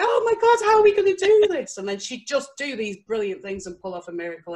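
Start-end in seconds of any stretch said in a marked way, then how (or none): 0.0-1.8 much higher and louder, hammed-up panicking cry; dramatic panic. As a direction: play the dramatic boss wailing that all is lost. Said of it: "she was like".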